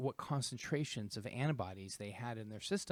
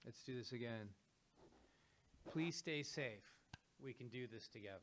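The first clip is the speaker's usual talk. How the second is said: softer, muffled behind a mask beak; plague doctor mask; 10 dB quieter than usual. Real, not acted. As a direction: talk softly through a plague doctor mask. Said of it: "beak is hitting the microphone".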